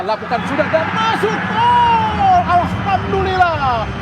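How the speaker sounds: high, slow goal shout; goal call. Real, not acted. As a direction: stretch out the goal call, loud. Commentator calls a penalty goal.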